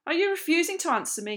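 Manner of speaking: high, loud challenge; indignant shout. A narrator's reading. Captain challenging a silent pupil with an angry shout. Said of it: "he shouted".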